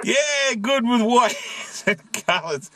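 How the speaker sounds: laughing through the words; slow, amused, giggle mid-phrase. Real, not acted. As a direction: repeat the joke, breaking into a laugh. Laughs repeating friend's washing joke.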